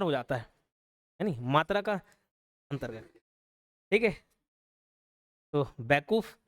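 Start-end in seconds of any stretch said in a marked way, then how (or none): 5.8-6.4 बैकूफ leaned on hard with a rising end, lower in pitch; strong stress. Shown as a demonstration. Teacher showing how Chhattisgarhi speakers stress the word, strong stress. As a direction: put heavy stress on बैकूफ. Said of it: "उस पे ज़्यादा ही stress दिया जाता है".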